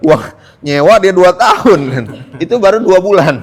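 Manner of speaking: laughing. Real, not acted. Laughing over the rent.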